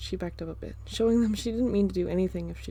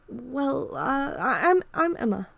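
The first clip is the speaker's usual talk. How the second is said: higher, hesitant stammer; shy girl voice; 5 semitones higher, 4 dB louder, slower than usual. Acted. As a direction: stammer shyly.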